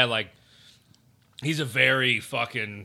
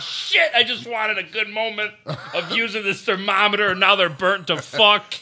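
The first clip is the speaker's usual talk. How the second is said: higher-pitched retold lament; mock exasperation; 6 semitones higher, 4 dB louder than usual. Son lamenting his ruined thermometer moment to dad, exasperated. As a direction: play up the exasperated complaint. Retold quarrel over burnt steaks.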